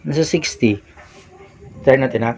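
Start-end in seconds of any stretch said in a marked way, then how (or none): none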